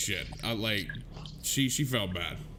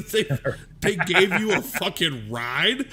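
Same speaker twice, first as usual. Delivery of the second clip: incredulous; 5 dB louder than usual. Retold or imitated. Retelling the free ride home from police, laughingly incredulous. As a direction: draw it out, astonished and laughing.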